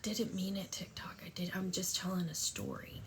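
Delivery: half-joking whispered apology; whispered aside. Real, not acted. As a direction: whisper it to the app as a sly confession. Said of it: "aside to TikTok".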